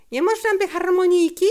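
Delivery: high, loud, quick plea; a child's eager shout, asking permission; excited cry. A narrator's reading. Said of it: "krzyknął".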